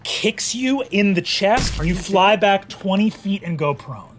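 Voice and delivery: ordinary talk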